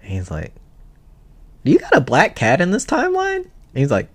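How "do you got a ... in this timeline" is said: high goofy voice. Retold or imitated. Friend voiced in retold story.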